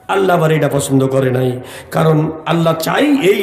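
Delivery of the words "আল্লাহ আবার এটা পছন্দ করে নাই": ordinary delivery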